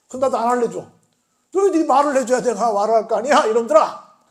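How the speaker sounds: loud, high exasperated retort; mock scolding. Preacher voices Jesus refusing the evasive elders, loud mock scolding. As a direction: scold them loudly, acting out exasperation.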